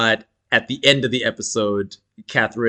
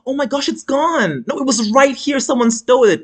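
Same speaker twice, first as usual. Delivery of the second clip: mock shock; 12 semitones higher than usual. [name] fakes shock at safe.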